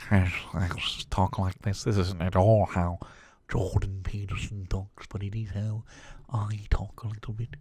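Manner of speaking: affected, self-important parody voice; great put-on voice. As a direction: keep up the mock intellectual voice. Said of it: "that's a great voice".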